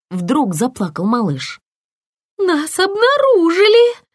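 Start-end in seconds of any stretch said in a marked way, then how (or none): 2.4-4.0 high, loud alarmed cry; a germ realizing they've been found; frightened cry. A narrator's reading. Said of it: "испугалась Элла". (испуганный крик)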